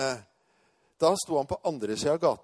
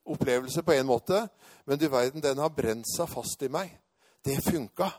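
ordinary talk

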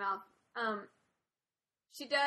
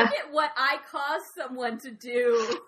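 slow, louder, half laughing boast; mock proud; 4 semitones higher, 10 dB louder, slower than usual. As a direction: gloat through laughter.

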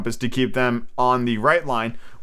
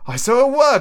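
high, louder eager shout; excited cry; 12 semitones higher, 6 dB louder than usual. A narrator's reading. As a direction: burst out in relief. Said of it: "cried Morgan".

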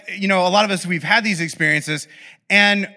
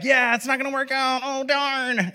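feigned regret; 7 semitones higher, 3 dB quieter than usual. Retold or imitated. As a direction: let them down with sing-song fake sympathy. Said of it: "but in your head".

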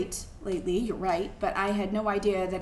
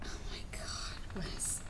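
breathless whisper; 4 semitones lower, 13 dB quieter, slower than usual. Acted. Wife moved by husband's confession, whispering. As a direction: barely breathe the words.

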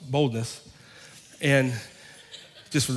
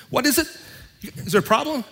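defensive challenge; 10 semitones higher than usual. Retold or imitated. Angry shopper snapping back at the preacher, mock challenge. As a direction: puff up like a cornered tough guy. Man confronted at checkout.

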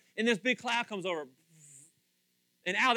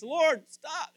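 raised, higher urgent plea; pleading prayer; 9 semitones higher, 6 dB louder than usual. Retold or imitated. Moses prays to God for the people, urgent plea. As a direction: cry out to God to make it stop. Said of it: "he prays".

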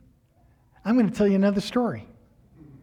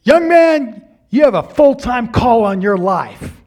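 preacher proclaiming; 4 semitones higher, 7 dB louder than usual. Retold or imitated. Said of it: "he points at me and he says".

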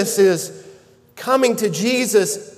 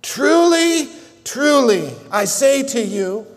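first "Truly" lifted high, then settling; solemn attention grabber; 4 semitones higher than usual. Acted. Pastor quoting Jesus to Nicodemus, solemn emphasis. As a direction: open like a solemn proclamation. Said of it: "this massive attention grabber".

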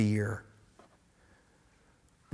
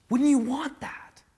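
much higher, pressing question; rising urgent plea; 13 semitones higher, 3 dB louder than usual. Real, not acted. Pastor presses the listener to want healing. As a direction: lift it in appeal.

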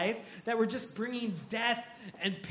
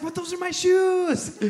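high, loud mock outcry; dismayed protest; 10 semitones higher, 7 dB louder than usual. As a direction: wail it in comic indignation. Protesting to hotel staff over shoes.

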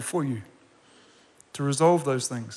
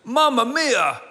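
higher Italian "Mamma mia!" with a laugh; Italian exclamation; 7 semitones higher, 3 dB louder, much slower than usual. Retold or imitated. Pastor mimicking his Italian obstetrician, hammed-up Italian accent. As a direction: mimic the Italian doctor's alarm. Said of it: "the obstetrician goes".

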